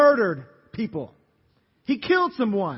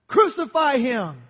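higher, louder, slow crucify him; loud cry; 5 semitones higher, 6 dB louder, slower than usual. Acted. The crowd demanding Jesus be crucified, slow loud shout.

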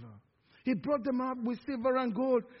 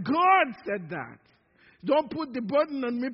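ordinary delivery

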